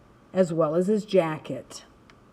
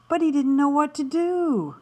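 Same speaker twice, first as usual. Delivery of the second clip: ordinary delivery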